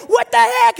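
high, loud mock panic; startled yell. Visitor startled by a Joker costume, acted startled yell. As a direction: yelp it as if jumped at.

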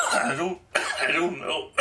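coughing and choking; choking while trying to talk; choking on a drink. Real, not acted. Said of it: "Sorry, I'm over here choking".

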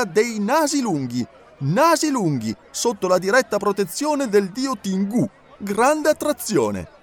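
barker's poster read. A narrator's reading. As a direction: proclaim the poster to the street. Reading the showman's poster.

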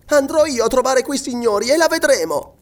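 put-on high, defiant boyish threat; threatening. A narrator's reading. Young boy vowing to confront those gentlemen, threatening. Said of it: "Robert non finì la minaccia".

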